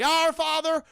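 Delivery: high, loud, chanted mock prayer; fervent praying. Missus Jones prays in her garden, high fervent chant. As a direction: pray in a strained crazy-lady wail. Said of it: "he just sees her praying".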